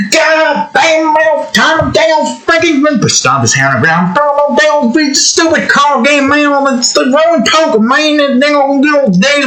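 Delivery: high put-on old cowboy voice, griping at a lost hand; high cranky old gambler cursing his luck; angry old cowboy. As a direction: squeal like a sore loser cowboy.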